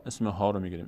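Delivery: ordinary talk, nothing marked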